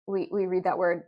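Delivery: usual speaking voice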